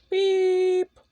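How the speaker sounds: high loud censor beep; mouth beep. Acted. Kid bleeping out a word in his story, loud high beep.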